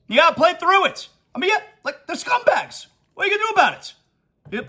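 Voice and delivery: getting carried away, pitch soaring; worked-up rant. Real, not acted.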